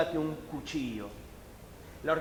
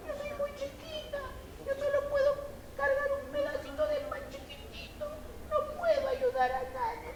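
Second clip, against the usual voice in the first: very high, small, whimpering ant voice; a tiny ant close to tears; little ant lamenting she can't help; sad tiny ant; 7 dB quieter than usual. Acted.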